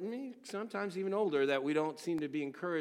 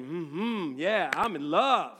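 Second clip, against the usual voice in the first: lovestruck sigh; 7 dB louder, much slower than usual. Retold or imitated. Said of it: "sitting there saying".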